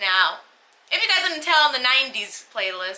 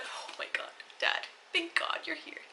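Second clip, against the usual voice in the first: whispered relief; 8 semitones higher, 12 dB quieter than usual. Retold or imitated. Retold relief at dad arriving.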